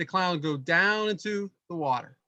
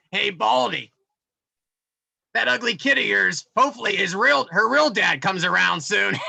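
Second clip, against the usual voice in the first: a louder, jeering carnival clown taunt; mock taunting clown; 4 dB louder than usual. Dad mimics clown mocking him at the fair, jeering loud. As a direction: heckle him like the dunking-booth clown. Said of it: "He's like".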